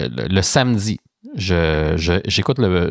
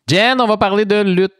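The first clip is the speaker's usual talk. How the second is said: excited announcement; 12 semitones higher, 4 dB louder than usual. Real, not acted. Host launching new topic.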